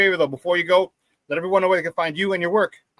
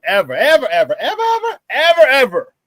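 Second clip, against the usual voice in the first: escalating disbelief; 7 semitones higher, 7 dB louder than usual. Real, not acted. Host needling guest about the Bulls ever paying, escalating disbelief. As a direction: build each ever higher and louder.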